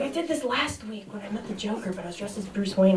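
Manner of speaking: quiet embarrassed muttering; muttered aside. Acted. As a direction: mumble it to yourself under your breath. Bruce muttering his excuse.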